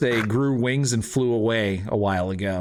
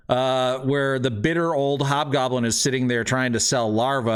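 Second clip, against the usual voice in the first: ordinary talk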